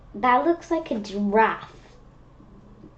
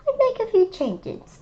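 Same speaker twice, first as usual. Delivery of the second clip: put-on a touch higher, boastful boy; Gerald's voice; 10 semitones higher than usual. Child voicing Gerald's plan, higher pitched.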